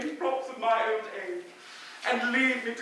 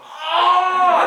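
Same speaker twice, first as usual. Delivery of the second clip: sudden exclamation; 7 semitones higher, 7 dB louder than usual. Acted. Sudden realisation of the misunderstanding.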